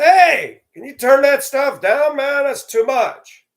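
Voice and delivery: high, loud yell over the music; yelling up at the neighbor to turn it down; calling over the fence. Retold or imitated. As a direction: shout across the fence to be heard.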